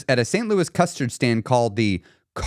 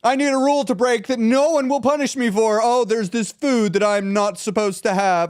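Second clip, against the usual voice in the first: defiant; 6 semitones higher than usual. Acted. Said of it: "you're feeling defiant".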